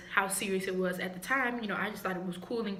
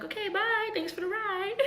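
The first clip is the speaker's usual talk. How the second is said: high, chirpy goodbye; pitched-up fake-cheery exit from the car; breezy mock-polite bye to the driver; polite brush-off; 12 semitones higher than usual. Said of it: "was like".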